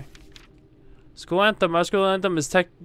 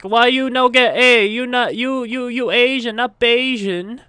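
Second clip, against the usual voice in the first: exaggerated Chinese accent, higher and slow at the end; mock Chinese parent accent; 8 semitones higher, 4 dB louder, slower than usual. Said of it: "or your parents will be like".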